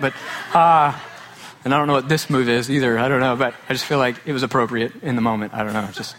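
usual speaking voice

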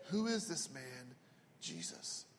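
low, softly spoken question; quiet, pondering delivery; hushed question. Retold or imitated. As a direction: drop to a hush and let the name sink away. Hushed rhetorical question in sermon.

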